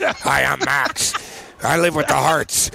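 lightly voiced creepy butler, drawn out; creepy butler Max. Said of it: "there was a creepy butler, Max, his voice".

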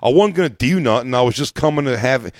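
put-on loud Philly accent. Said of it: "arguing in Philly accents".

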